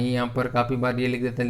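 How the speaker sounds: usual speaking voice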